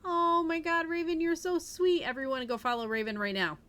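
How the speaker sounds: real overjoyed streamer; excited. Real, not acted. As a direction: squeal with real delight at the gift. Streamer gushes over Raven.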